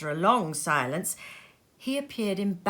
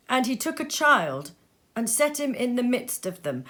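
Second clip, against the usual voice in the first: ordinary delivery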